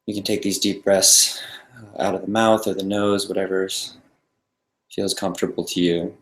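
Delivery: soft, calm meditation guide with long pauses; guided meditation. Real, not acted. Meditation teacher settling a group into the breath, calm guiding voice. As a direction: ease them into stillness, leaving silence between phrases.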